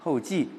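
后骑 said hòu jì, both falling; 骑 read as jì. Shown as a demonstration. Teacher gives the reading for the scouts, 骑 said jì. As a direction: pronounce 骑 in 后骑 as falling jì. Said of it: "肯定是读后骑".

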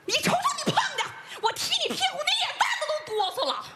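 high, rapid-fire comic tirade; fast, high-pitched nagging rant; angry scolding. Acted. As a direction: tear into him with mocking indignation. Wife berates husband's false claim.

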